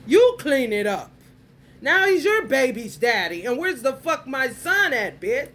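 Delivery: high, forceful, drawn-out outrage; heated rant. Real, not acted. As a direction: pitch climbing as the rant builds.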